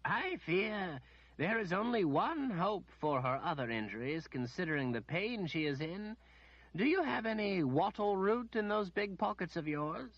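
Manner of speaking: a lightly voiced, patient old wizard; the wizard calmly answering the demanding lady. A narrator's reading. Wizard to healer.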